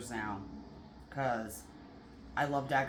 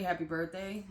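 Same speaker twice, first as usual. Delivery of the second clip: normal talking voice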